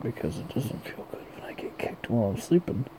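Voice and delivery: soft unvoiced whisper. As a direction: breathe it out as a whisper. Whispered sleepy thought.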